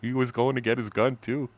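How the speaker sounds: ordinary delivery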